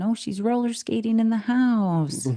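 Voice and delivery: high, gushing onlooker imitation; exaggerated sing-song delight; mock cheery. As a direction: put on a gleeful high voice. Mimics onlookers' cheery view of them.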